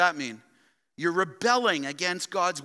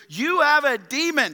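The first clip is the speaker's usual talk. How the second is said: loud, higher accusing shout; forceful accusation; 9 semitones higher, 6 dB louder than usual. Acted. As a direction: hurl it at him as a charge. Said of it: "they say to Christ forcefully".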